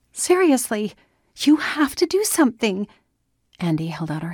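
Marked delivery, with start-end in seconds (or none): 0.1-2.9 high, insistent demand; urgent pleading. A narrator's reading. Daughter begging her father to help the neighbour, urgent. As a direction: press hard, pitch raised well up.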